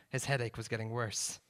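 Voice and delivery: ordinary delivery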